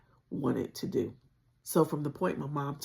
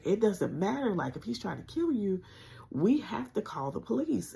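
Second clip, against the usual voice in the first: higher, urgent and firm; insistent; 7 semitones higher than usual. Retold or imitated. The lady insisting she call the police, urgent. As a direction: press her hard to call the police.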